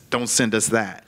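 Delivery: normal talking voice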